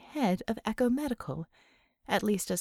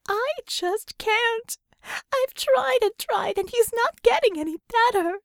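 high, slow, louder tearful wail; rising wail; 13 semitones higher, 6 dB louder than usual. A narrator's reading. Einhorn despairing to Ramona over a patient, in a high slow wail. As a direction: let the voice spiral up into a wail. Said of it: "Her voice spiraled up into a wail".

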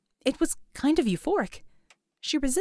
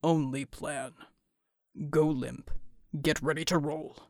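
deep big-man voice; 10 semitones lower, 6 dB quieter than usual. A narrator's reading. Bull's voice.

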